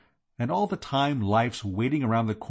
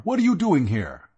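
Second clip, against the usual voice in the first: alarmed; 7 semitones higher, 7 dB louder than usual. Acted. Alarmed at the detective.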